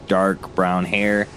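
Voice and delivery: ordinary talk, nothing marked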